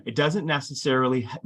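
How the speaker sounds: ordinary talk, nothing marked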